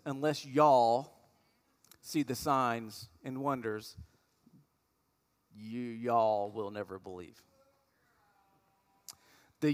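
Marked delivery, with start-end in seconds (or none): 0.1-7.4 slow, drawn-out Texas accent on y'all. Shown as a demonstration. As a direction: lean into a Texas drawl, lower at the end. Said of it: "the Texas version would have said this".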